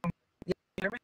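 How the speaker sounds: faint, crackly bad cell signal; breaking up phone line. Real, not acted. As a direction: voice cutting out over a weak connection. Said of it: "you're breaking up pretty bad".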